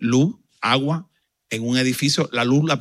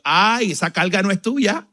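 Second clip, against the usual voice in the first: slow, higher, louder prophetic voice; prophetic declaration; 9 semitones higher, 7 dB louder, slower than usual. Retold or imitated. Pastora prophesying over the pastor, slow, high and loud. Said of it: "comenzó a profetizar".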